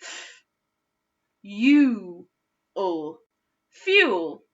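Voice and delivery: "fuel" as fyool; u-way blend. Shown as a demonstration. Teacher trying the second vowel for pupils. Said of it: "Let's do that one together".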